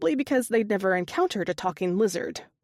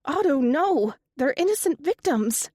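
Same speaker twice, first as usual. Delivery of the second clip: higher, louder shocked cry; gasping alarm; 7 semitones higher, 3 dB louder than usual. A narrator's reading. Katie on the phone, stopping Otto in shocked alarm. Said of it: "Katie gasped".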